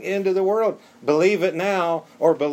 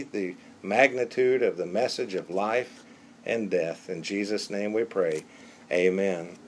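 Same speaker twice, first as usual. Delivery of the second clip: prayer; 4 semitones lower than usual. Acted. Pastor praying over the congregation, hushed and earnest.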